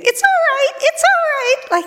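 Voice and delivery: very high, squeaky, slowing down; nervous tiny-voiced soothing; high, squeaky imitation of her panicked self; squeaky reassurance. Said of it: "There was the sound of [name] saying".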